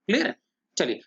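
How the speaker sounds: ordinary delivery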